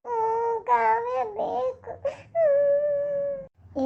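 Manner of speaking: put-on very slow, high wailing sob in a child's voice; sobbing child. Little sister crying to grandmother for Kavya. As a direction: wail for her missing sister.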